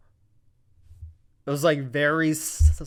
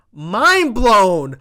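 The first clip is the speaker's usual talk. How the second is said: much louder, higher mind blown; excited shout; 6 semitones higher, 12 dB louder than usual. Real, not acted. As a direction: yell it in disbelief. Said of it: "And I was".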